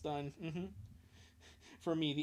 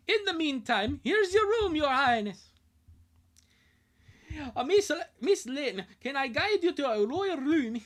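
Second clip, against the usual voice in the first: Scottish voice; 10 semitones higher, 5 dB louder than usual. Acted. Scottish steward Angus welcomes the kids home in Scottish accent. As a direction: boom it out like a Scottish castle keeper. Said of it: "the Scottish voice".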